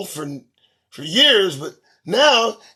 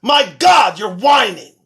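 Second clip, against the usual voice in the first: exasperated; 2 semitones higher, 7 dB louder than usual. Real, not acted. Ranting at protesters.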